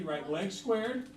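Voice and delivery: very slow, spaced dictation; slow dictation to the class; dictating slowly. Shown as a demonstration. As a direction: give them time to write each word. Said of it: "I want you to write this down".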